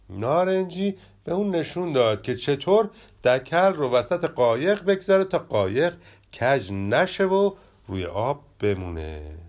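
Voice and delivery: ordinary delivery, nothing marked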